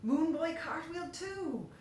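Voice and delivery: plain reading voice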